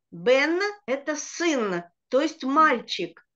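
ordinary talk, nothing marked

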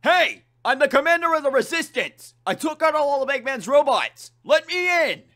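loud, high demanding yell; shouting at the gate. Acted. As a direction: bellow to be let in. Knuckles demanding entry at gates.

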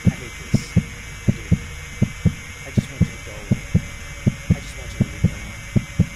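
voiceless, fearful whisper; tense, unvoiced begging; whispered pleading. Real, not acted. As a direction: whisper a plea to be left alone. Cornered, pleading with threat.

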